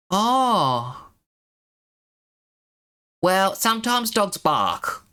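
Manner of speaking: high, drawn-out mock sympathy; dismissive council worker. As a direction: brush the caller off in a slow, high official voice. Mocking the council's dismissive reply.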